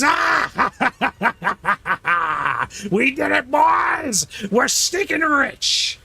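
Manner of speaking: hammed-up maniacal cackling shout; maniacal villain. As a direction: crow over the loot like a madman. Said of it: "maniacal male voice".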